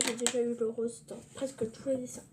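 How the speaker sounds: ordinary talk, nothing marked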